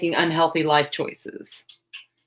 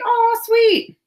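much higher, bright squeal of delight; 4 dB louder than usual. Real, not acted. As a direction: gush over the viewer's art. Reacting to viewer's drawing.

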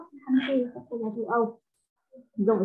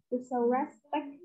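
slow dictation; 5 dB quieter, much slower than usual. Shown as a demonstration. Teacher repeats phrase slowly.

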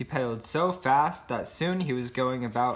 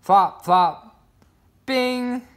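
flop and bing sounds; 11 semitones higher, 7 dB louder, much slower than usual. A narrator's reading. Sound words.

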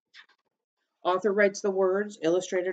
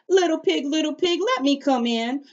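higher, louder, sweetly coaxing call; sweet coyote call; 10 semitones higher, 5 dB louder than usual. Acted. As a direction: lure the pig out with a sweet sing-song. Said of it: "He called out sweetly".

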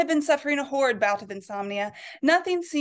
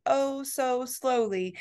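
very slow; 2 semitones higher, much slower than usual. A narrator's reading. Narration of slow careful movement.

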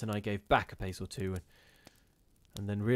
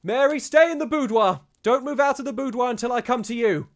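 loud, high shout across the house; calling out at the top of his voice; 14 semitones higher, 10 dB louder than usual. Acted. Husband shouting to Mary in the boudoir, loud high call. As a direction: bellow it so she hears from the boudoir. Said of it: "called out at the top of his voice".

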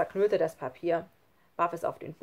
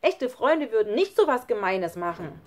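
loud, raised, indignant; angry outburst; 5 semitones higher, 8 dB louder than usual. A narrator's reading. Lukas contradicts Schlunz, angry outburst. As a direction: burst out with the pent-up hurt. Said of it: "Da platzte es aus Lukas heraus".

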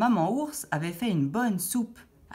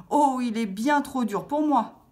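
higher-pitched storybook complaint; dismayed complaint; 7 semitones higher, 4 dB louder than usual. A narrator's reading. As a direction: exclaim it like a put-out little girl. Goldilocks complains about hard chair. (plainte contrariée, voix plus haute)

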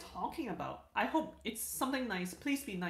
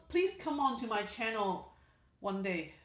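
ordinary talk

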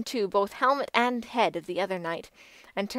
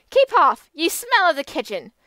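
loud, high disgusted shout; shrill-free but loud contempt; cried; 7 semitones higher, 8 dB louder than usual. A narrator's reading. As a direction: drive him off with scorn. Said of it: "she cried".

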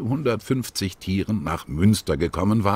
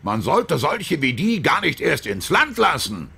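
angry outburst; 8 semitones higher than usual. A narrator's reading. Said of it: "Doch der rief laut".

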